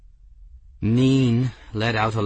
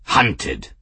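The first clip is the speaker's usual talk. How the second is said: snapped; 5 semitones lower, 4 dB louder than usual. A narrator's reading. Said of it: "Raoul snapped".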